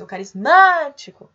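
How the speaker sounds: ringing call peaking on the "má" of "carismático". Shown as a demonstration. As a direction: call "carismático", leaning long on the third syllable. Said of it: "Se vocês chamarem".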